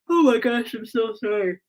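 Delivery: talking through a real yawn; words stretched by a tired yawn. Real, not acted. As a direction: apologize while a yawn takes over. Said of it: "I'm not yawning 'cause I'm bored".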